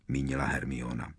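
plain reading voice

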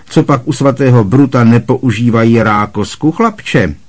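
loud, high bark; barked question. A narrator's reading. Aunt Marge grilling Harry across the table, loud bark. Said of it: "vyštěkla přes stůl".

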